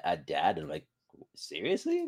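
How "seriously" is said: high, soft questioning seriously; mock disbelief, voice pitched up; incredulous. Cohost retelling his reaction to a dad joke, incredulous.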